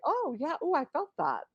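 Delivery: gentle realization. Retold or imitated. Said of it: "integrated to say".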